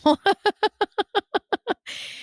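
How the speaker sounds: high, breathy burst of laughter. Real, not acted. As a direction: laugh it off, amused. Laughs at own story.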